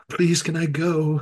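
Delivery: put-on nervous pleading; mock anxious plea. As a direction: beg slowly to be let off, feebly acted. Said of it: "he's riddled with anxiety".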